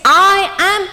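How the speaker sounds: slow, high, proud declaration; loud affirmation. Shown as a demonstration. Coach showing the volunteer a loud affirmation. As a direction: say your name with energy. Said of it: "loudly you just say".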